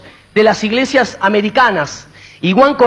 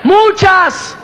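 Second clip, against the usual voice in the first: preaching shout; 6 semitones higher, slower than usual. Real, not acted. Preacher exhorting the congregation.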